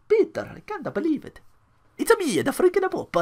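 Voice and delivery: put-on comic Italian accent, Pope talking to Peter; cartoon Italian Pope. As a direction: squeeze it up into a silly Italian Pope. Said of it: "It's, it's fun accent".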